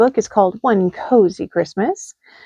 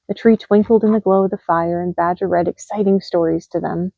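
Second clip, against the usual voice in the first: usual speaking voice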